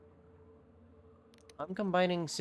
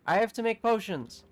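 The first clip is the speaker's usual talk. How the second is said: louder, higher exclamation; urgent insistence; 7 semitones higher, 5 dB louder than usual. Real, not acted. Gamer suddenly set on brewing, urgent and insistent. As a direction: burst out with it, raised and emphatic.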